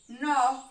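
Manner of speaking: loud, separated syllable Nó; teacher counting syllables in outono; syllable clapped out. Shown as a demonstration. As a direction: punch out Nó as its own beat. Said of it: "Eu vou marcar a sílaba".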